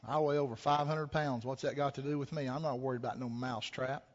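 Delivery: low, quiet, unbothered cow; lightly voiced dismissive cow. Cow dismisses mouse's warning.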